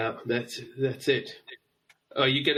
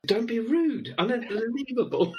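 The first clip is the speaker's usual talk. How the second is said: high-pitched, joking indignation; mock outrage; 10 semitones higher than usual. Host ticking off a guest for a cheeky reply, mock outrage. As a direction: scold them in pretend disbelief.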